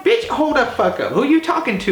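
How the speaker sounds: high, sharp mock retort; retold comeback. Retelling snapping back at the yelling neighbor. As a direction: fire it back at her, indignant. Confronting neighbor at her door.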